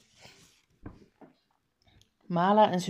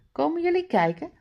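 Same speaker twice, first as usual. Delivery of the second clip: higher, fast call down the stairs; 10 semitones higher, faster than usual. A narrator's reading. Mala calls her parents to come look, high and quick. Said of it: "roept Mala".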